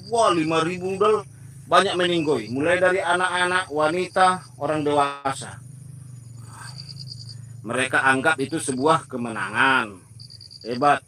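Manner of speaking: ordinary delivery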